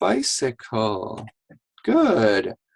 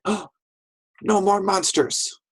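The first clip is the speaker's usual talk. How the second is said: higher-pitched pretend child's call; playful fort defender; 8 semitones higher than usual. Child looks over the fort wall, playful defiance. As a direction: pretend to ward off monsters, pitched up.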